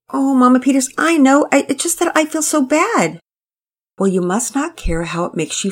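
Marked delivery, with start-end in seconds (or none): 0.1-3.2 put-on high, eager-to-please girl rushing on; tired young girl. A narrator's reading. Penelope explains herself to Mama Peters, high and earnest. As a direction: plead earnestly, a bit flustered.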